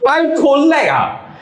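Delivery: high disbelieving echo; stunned he's asked to fetch it himself; incredulous. Retold or imitated. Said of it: "the guy looks at him and then goes".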